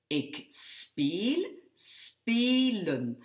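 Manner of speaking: speel and spelen with the E held long. Shown as a demonstration. Teacher letting students hear it, speel, spelen with long E. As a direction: draw out the vowel in speel and spelen. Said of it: "j'entends bien que mon son est long".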